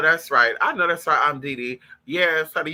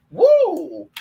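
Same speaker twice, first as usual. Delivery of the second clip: loud whoop; 9 semitones higher, 8 dB louder than usual. Real, not acted. Whoop after the outburst.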